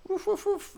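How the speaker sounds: light, high woofing; imitated dog barks. Film fan predicting how the dog will talk, imitated barks. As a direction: do a quick mock dog bark. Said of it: "the dog is gonna be like".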